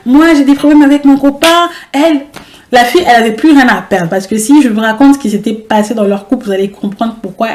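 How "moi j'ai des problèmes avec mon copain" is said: higher, louder put-on voice of the angry girl; imitating the rival. Rival explaining her boyfriend trouble to the cousins, imitated. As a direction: mimic her explaining herself. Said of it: "Elle explique en plus".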